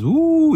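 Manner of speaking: high, drawn-out "ooh"; delighted "ooh". Real, not acted. Host moving on to the wings, delighted high ooh. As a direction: let out a high, long ooh.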